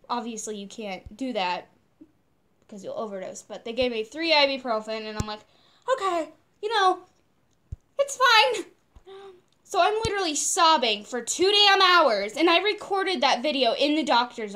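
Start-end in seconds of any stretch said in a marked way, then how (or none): 5.9-8.6 high, squeaky mock reassurance; strained coping. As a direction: pitch it way up as if holding back tears. Acts pretending to cope while hurting.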